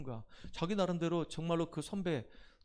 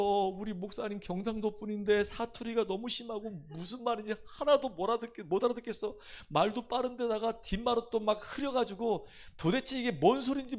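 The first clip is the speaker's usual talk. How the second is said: higher-pitched complaining daughter; whiny daughter; 8 semitones higher, 4 dB louder than usual. Retold or imitated. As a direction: whine like a teenage girl fed up with church. Daughter complaining about church sermons. (높은 목소리로 투덜대는 딸 흉내)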